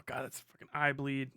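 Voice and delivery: ordinary talk, nothing marked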